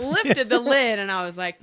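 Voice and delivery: usual speaking voice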